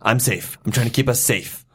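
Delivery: ordinary delivery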